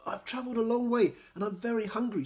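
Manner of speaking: Noko. A narrator's reading. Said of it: "said Noko".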